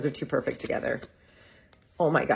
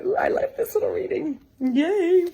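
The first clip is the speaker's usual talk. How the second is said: much higher, bubbly ooh and yay; gleeful cheer; 13 semitones higher than usual. Real, not acted. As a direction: cheer like it made your day. Thrilled with her tarot reading.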